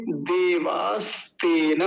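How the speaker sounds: देवास्तेन stretched out, each syllable clear and very slow; slow careful pronunciation. Shown as a demonstration. Teacher showing students a mantra word, very slow. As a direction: lay out देवास्तेन slowly for the class. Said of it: "अगला शब्द देखिए".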